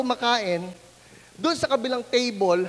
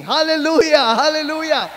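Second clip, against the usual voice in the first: loud, high praise; exultant hallelujah; joyful shout; 5 semitones higher, 8 dB louder than usual. Real, not acted. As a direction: shout it out to the congregation. Preacher celebrates.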